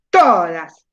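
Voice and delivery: "todas" with a big, long TO, falling away; exaggerated stressed syllable. Shown as a demonstration. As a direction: overdo the TO in "todas". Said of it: "exageren y digan".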